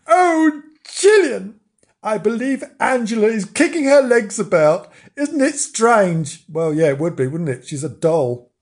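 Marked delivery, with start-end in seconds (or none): 0.1-6.4 put-on high, wondering child voice, slowing at the end; astonished girl. Imogen telling Gillian the doll is kicking, astonished. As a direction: pitch it up in amazed wonder.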